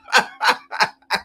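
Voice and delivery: laughing after a jokey curse. Real, not acted. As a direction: loose burst of his own laughter. Laughs after cursing out commenters.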